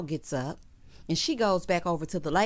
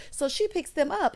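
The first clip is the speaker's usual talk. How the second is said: ordinary talk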